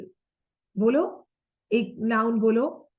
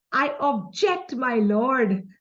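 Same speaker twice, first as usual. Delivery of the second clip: Bollywood courtroom objection, "object" stressed on JECT; slower than usual. Shown as a demonstration. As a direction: hit the second syllable of "object", drawn out. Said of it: "which is the second syllable".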